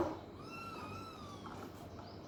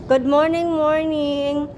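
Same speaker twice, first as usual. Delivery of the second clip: cheery greeting; 3 semitones higher, 6 dB louder, much slower than usual. Real, not acted.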